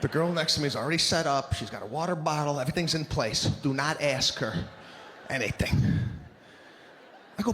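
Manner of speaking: ordinary delivery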